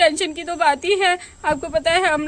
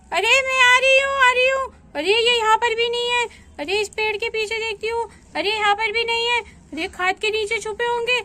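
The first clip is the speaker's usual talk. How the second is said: put-on high, bright child voice searching; a small girl hunting for hiding friends; little girl playing; 5 semitones higher than usual. Girl seeking her friends in hide and seek, high childish voice.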